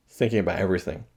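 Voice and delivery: usual speaking voice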